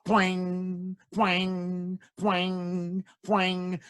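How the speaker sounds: springy twang for each hop; boing-like hop sound; high twang effect; twanging hop noise. Acted. Frog hopping sound effect.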